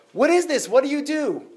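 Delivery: high, startled questions; astonished rabbi. Acted. Rabbi Akiva presses the burdened man, astonished. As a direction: voice Rabbi Akiva jolted by what he sees.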